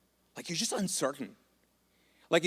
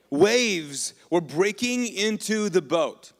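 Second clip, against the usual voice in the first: dramatic storm reading; 5 semitones higher, 6 dB louder, much slower than usual. A narrator's reading.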